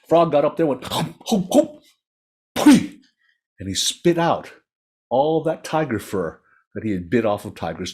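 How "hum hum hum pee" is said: comic build-up to a sharp ptooey; hawking and spitting. A narrator's reading. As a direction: mimic a frog working up spit. Imitates frog hawking and spitting.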